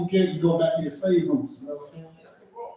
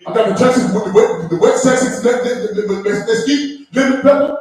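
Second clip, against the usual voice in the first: loud, high, stuttering barbecue-man impression; Shannon Sharpe; 7 semitones higher, 6 dB louder, much faster than usual. Sports host bragging about lemon pepper, thick-lipped stammer. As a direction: stumble over words with big loose lips. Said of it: "Shannon Sharpe talks like he's very good at barbecue".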